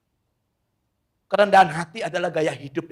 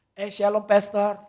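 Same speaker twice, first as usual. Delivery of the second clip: higher-pitched acted greeting of a guest; light mock-delighted welcome; host's eager greeting; 6 semitones higher, 4 dB louder, slower than usual. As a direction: greet the pastor as an arriving host, voice lifted. Host arrives and greets the pastor.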